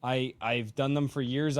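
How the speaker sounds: ordinary talk, nothing marked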